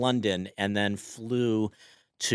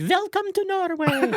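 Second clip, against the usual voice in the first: strong Norwegian accent, much higher. Shown as a demonstration. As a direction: play up a sing-song Norwegian accent. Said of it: "Can you recreate that accent now?".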